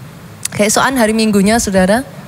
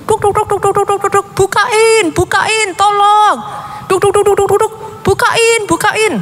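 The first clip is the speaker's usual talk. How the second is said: put-on much higher, urgent boy voice banging out duk duk; boy in the rain begging someone to open up, much higher; child knocking and calling; 12 semitones higher than usual. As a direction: knock and plead to be let in.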